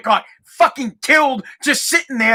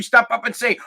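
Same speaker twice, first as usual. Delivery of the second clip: ordinary talk, nothing marked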